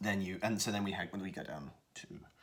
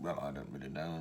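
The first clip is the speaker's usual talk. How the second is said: low curmudgeonly mumble; muttering old father, slightly slurred; 4 semitones lower than usual. Shown as a demonstration. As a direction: mumble and grumble to yourself. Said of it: "muttering away".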